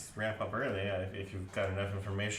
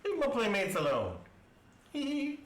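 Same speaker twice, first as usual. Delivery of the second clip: high giggly little voice; tiny giggling sprite voice; pixie; 11 semitones higher than usual. Acted. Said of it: "you hear a little voice go".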